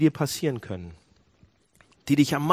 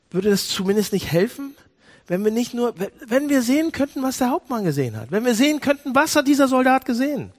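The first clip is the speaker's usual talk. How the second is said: preaching hard and climbing higher, louder; full-force preaching; 8 semitones higher, 5 dB louder than usual. Acted. Preacher urging the congregation, rising to full-force preaching. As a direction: drive the point home from the pulpit.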